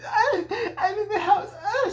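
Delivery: very high, whimpering falsetto shrieks; hammed-up terrified wail; pathetic mock panic. Alice barricading herself in the cabin, pathetic mock shrieking. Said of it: "most pathetic thing ever".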